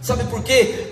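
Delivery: usual speaking voice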